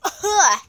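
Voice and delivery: loud startled laugh; short burst of laughter. Real, not acted. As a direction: laugh out at the bad taste. Reacting to tasting honey.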